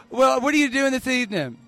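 usual speaking voice

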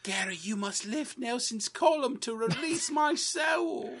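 high, eerie ghostly command. Acted. Ghost of Chip commanding Gary to free his soul, high and eerie. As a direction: intone it like a haunting spirit.

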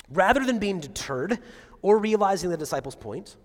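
ordinary talk

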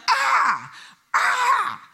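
put-on high yelping "ah"s like a hurting little girl; mock the daughter's pained squeals; imitating child's cries. Daughter's cries heard by Rosie.

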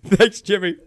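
high laugh into amused thanks. Real, not acted. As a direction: say it through laughter. Laughing at the mock sign-off.

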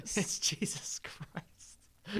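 very slow, hushed whisper; awed whisper. Acted. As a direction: whisper it like a stunned reveal. Mock-awed echo of a joke reveal.

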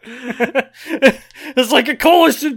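high, loud, slow indignant yell; Joseph cola shout. Retold or imitated. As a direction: bellow it like an outraged tourist. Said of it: "Joseph shouting about hot cola".

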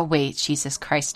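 ordinary talk, nothing marked

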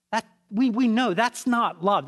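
preacher's emphatic declaration; emphatic insistence. Real, not acted. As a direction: drive home that this is not love. Preacher insists love is sacrifice.

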